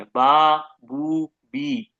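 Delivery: बा loud, then बू and बी drawn out very slowly; lip sound drill. Shown as a demonstration. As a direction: sound बा बू बी from the lips, stretching the last two. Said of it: "हलक का बिल्कुल भी इस्तेमाल नहीं है". (होंठों से बा, बू, बी बहुत धीरे खींचकर)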